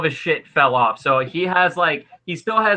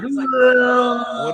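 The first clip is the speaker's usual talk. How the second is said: higher, shouted death groan; demon's shouted groan; 9 semitones higher than usual. Acted. GM voicing the demon dragged into the sword, shouted groan. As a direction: howl as he's sucked into the sword. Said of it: "he's like".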